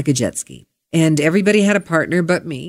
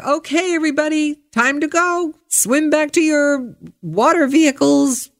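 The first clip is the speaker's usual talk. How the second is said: calling out; 10 semitones higher than usual. Retold or imitated. Guide calling out to swimmers.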